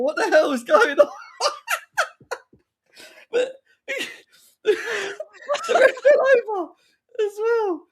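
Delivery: high voice breaking into real laughter; can barely get words out for laughing; laughing hard. Real, not acted. Fan cracking up, high and laughing, at the ref falling over.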